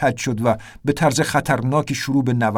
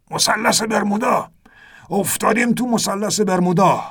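put-on higher, alarmed old sailor voice; old captain shouting; 6 semitones higher than usual. A narrator's reading. Ernest shouting to the narrator about the Bermuda Triangle, alarmed. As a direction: bark the news like a frightened captain. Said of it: "فریاد زد".

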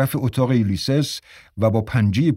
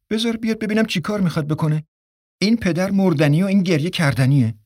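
defiant, higher and fast; stubborn; 8 semitones higher, faster than usual. A narrator's reading. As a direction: dig in your heels, quick and high. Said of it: "لجوجانه گفت".

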